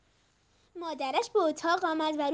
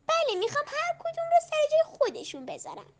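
put-on eager little girl; 8 semitones higher than usual. A narrator's reading. Child answers mother.

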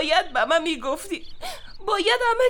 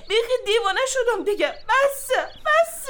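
climbing higher to a cry of enough; losing control, pleading; rising breakdown; 6 semitones higher than usual. Acted.

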